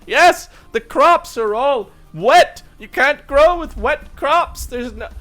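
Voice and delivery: high, slow, overwrought wailing; distraught puppet priest. Acted. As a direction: lament loudly like a hammy stage puppet. Game master voices second priest.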